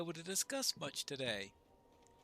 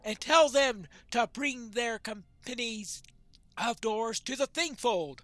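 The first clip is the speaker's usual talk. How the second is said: loud, higher-pitched rallying orders; commanding quest-giver; 4 semitones higher, 8 dB louder than usual. Acted. Leader sends for the dwarf captains, commanding. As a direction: declaim the summons with force.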